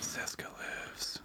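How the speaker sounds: hushed, drawn-out whisper; mock ominous whisper. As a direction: whisper it slowly like a spooky secret. Mock ghostly whisper about alleged death.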